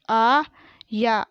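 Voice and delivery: आया split into long aa and yaa; very slow आया, syllables held apart; spelled out. Shown as a demonstration. Teacher sounding out आया for pupils, syllable by syllable. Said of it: "मैंने इस तरीके से पढ़ा".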